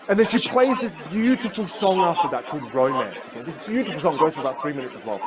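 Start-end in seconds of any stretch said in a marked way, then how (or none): none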